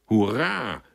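hearty shout of welcome; jubilant call. A narrator's reading. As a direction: call out a rousing hurrah. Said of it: "rief Stickler".

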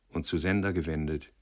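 plain reading voice

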